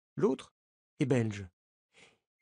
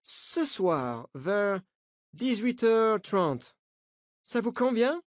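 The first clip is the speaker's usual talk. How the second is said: put-on the coach, higher, with an American accent; 6 semitones higher, slower than usual. A narrator's reading. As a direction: reassure the journalists in an American accent. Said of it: "avec un accent américain". (accent américain, rassurant)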